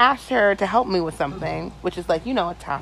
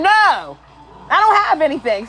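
exasperated refusal, higher and louder; irritated; 5 semitones higher, 5 dB louder than usual. Retold or imitated. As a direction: shut down the request with mock irritation. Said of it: "So I'm getting irritated".

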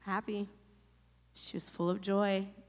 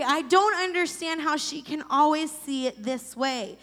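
louder and higher, venting frustration; angry rant; 5 semitones higher, 5 dB louder than usual. Real, not acted. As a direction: let the anger spill out. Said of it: "I was angry".

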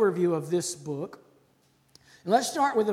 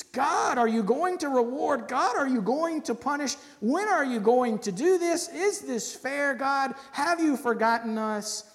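pleading complaint; 6 semitones higher, 4 dB louder than usual. Acted. Preacher voicing Habakkuk's complaint.

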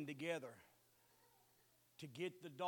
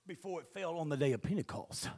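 ordinary delivery

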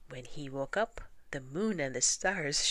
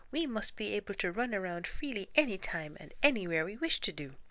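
fast, much higher excited squeak; eager mice; 6 semitones higher, 3 dB louder, faster than usual. Acted. Mice dreaming of running free, fast and eager. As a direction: tumble the words out in excitement.

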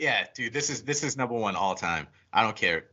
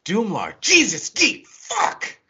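rising, higher sneering outburst; mock angry Kane; 9 semitones higher, 4 dB louder, slower than usual. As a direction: spit it out at the geek, climbing up. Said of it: "And Kane just goes".

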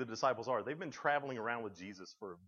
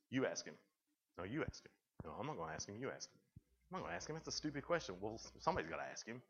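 hushed bickering; 7 dB quieter, faster than usual. Retold or imitated. Disciples pushing each other to ask, hushed bickering. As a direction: murmur it so the blind man won't hear. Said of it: "starting to whisper amongst each other".

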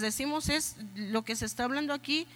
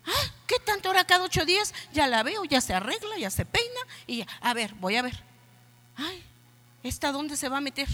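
higher, fast, suspicious nagging voice; jealous husband; 5 semitones higher than usual. Retold or imitated. Pastor voicing a suspicious husband spying on his wife, high and fast. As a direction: play the snooping husband, quick and much higher. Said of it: "el esposo empieza".

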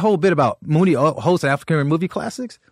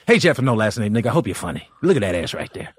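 Uncle Ray voice; 8 semitones lower than usual. Retold or imitated. Uncle Ray greets Paul in the green room, low and brash. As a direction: lower gruff greeting. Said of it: "He's like".

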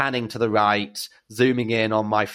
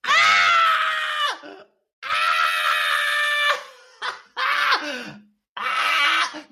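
excited screams; much slower than usual. Retold or imitated. Drag queen spotting the teen again, squealing with joy. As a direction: scream with delight at the reunion. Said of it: "And I went".

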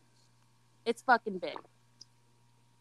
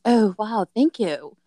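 clumsy mock British accent, a bit louder; bad British accent; 5 dB louder, slower than usual. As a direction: fake a British accent badly. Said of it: "I fucking suck at a British accent".

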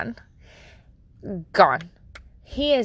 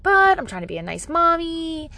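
usual speaking voice

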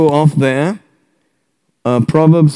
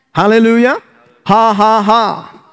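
three measured, higher-pitched has; deliberate laugh; 5 semitones higher than usual. Shown as a demonstration. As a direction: laugh out three even ha's. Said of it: "Jesus laughs, you laugh".

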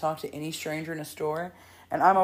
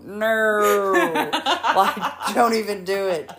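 put-on loud flat refusal, toddler style; firm no; 4 semitones higher, 8 dB louder, much slower than usual. Mom imitating her toddler refusing strangers, loud. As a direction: shut them down with one loud word. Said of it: "point at the people ... and go".

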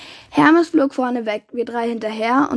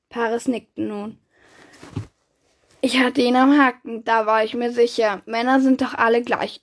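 ordinary delivery

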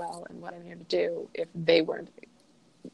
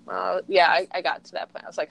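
ordinary delivery

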